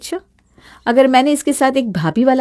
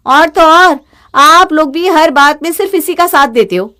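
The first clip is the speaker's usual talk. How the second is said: higher and louder, fed up with the family; angry outburst; 5 semitones higher, 7 dB louder than usual. Acted. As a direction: snap at them in anger. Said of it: "वर्षा गुस्से में वहां से चली जाती है".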